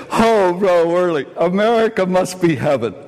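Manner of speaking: high, laughing quote of awe; light awed voice, laughing; amused mock awe. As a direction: voice their wonder while chuckling. Quotes awed students amid laughter.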